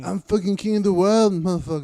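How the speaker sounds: mock victory boast. Friend playing the fighter after his win, mock loud boast. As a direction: crow like a mock champion.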